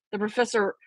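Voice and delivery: plain reading voice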